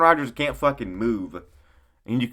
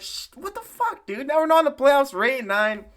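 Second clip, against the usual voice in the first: much higher and louder, exasperated; mock outrage; 13 semitones higher, 4 dB louder than usual. Mocking a fan cursing after a missed field goal, in a high loud yell. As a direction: blow up like a fan who just lost a playoff spot.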